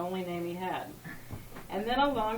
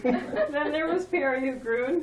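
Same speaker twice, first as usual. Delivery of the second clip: laughing; 5 semitones higher, 6 dB louder than usual. Real, not acted. Reader cracks up rereading line.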